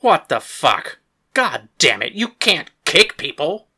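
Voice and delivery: angry outburst. Acted. The kicked boxer yells at Paris, outraged.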